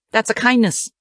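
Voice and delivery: ordinary delivery, nothing marked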